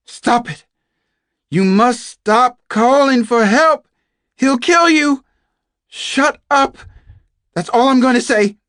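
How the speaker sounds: high, urgent yell of warning; shouting at full volume. Acted. Frightened captive ordering another to stop calling, shouting. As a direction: yell as loud as you can to silence her. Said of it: "shouted as loud as she could".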